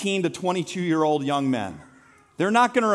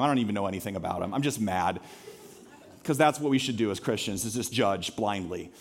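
much lower, softer, quick deadpan; sarcastic aside; 9 semitones lower, 6 dB quieter than usual. Acted. Pastor to congregation, quiet deadpan sarcastic aside. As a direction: throw it away as ironic confession.

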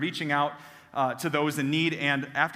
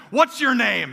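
loud, higher curt question; blunt demand; 7 semitones higher, 7 dB louder, slower than usual. Retold or imitated. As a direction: demand his name like a threat. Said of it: "And he said".

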